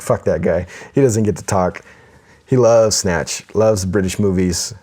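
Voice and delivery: ordinary talk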